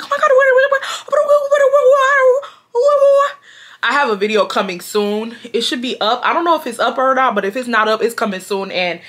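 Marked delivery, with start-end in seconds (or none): 0.0-3.4 very high squealing what what what; mock shocked commenters. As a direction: shriek it like stunned fans in the comments. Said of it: "the comments is about to be like".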